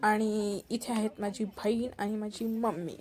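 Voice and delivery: normal talking voice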